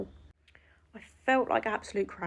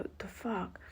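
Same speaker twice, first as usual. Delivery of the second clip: much softer, muttered disbelief; stunned under-breath curse; 11 dB quieter, slower than usual. Retold or imitated. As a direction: mutter it to yourself in shock. Stunned in the middle of airport.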